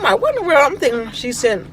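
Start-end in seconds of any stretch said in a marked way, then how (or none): 0.2-0.6 astonished. Retold or imitated. Retold shock opening package.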